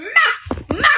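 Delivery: high yelp of ma; mock yell. Nurse yelling while pulled by her hair, high yelp. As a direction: cry out while being dragged.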